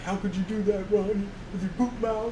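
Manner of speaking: high, whiny upset producer voice. Retold or imitated. Quoting a weeping producer from Anchorman, high and whiny. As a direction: plead in a high, hurt whine. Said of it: "he's so upset".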